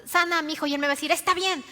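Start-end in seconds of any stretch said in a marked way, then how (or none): 0.0-0.7 higher-pitched acted plea; imagine urgently asking for a healing. Father imagining his request to Jesus, urgent mild plea. 1.1-1.6 higher, louder acted assent; eager agreement. Jesus agreeing in the father's imagination, eager acted assent.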